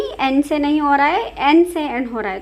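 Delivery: ordinary talk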